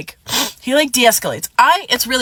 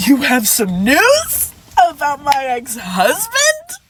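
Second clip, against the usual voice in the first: slow, higher, wailing disbelief; incredulous; 4 semitones higher, much slower than usual. Retold or imitated. Replying to the ex about her ex-husband, slow wailing disbelief. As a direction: drag it out like she can't believe it.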